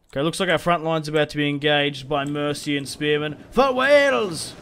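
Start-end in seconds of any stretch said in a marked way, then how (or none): 3.5-4.5 put-on loud, high rallying cry in a try at a Welsh accent; mock Welsh battle cry. Rallying his Welsh army, loud high battle cry. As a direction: bellow it like a Welsh warlord. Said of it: "I don't know what a Welsh accent is".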